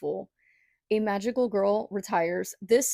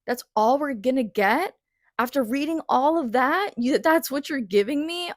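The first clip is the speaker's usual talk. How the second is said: higher and louder, mock outraged disbelief; retelling her own let-down, in outraged disbelief; indignant disbelief; 5 semitones higher, 4 dB louder than usual. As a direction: demand it of the book, raised and stunned. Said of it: "I was like".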